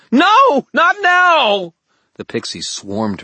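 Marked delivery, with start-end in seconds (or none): 0.1-1.7 very high, very loud howl; howled scream. A narrator's reading. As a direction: howl it in despair. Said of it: "she howled".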